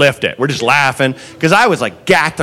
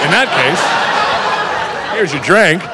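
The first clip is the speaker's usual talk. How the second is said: slow, laughing mock surrender; mock sarcastic; much slower than usual. Bartender mockingly gives in to the customer, laughing. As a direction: hand it over with dripping sarcasm.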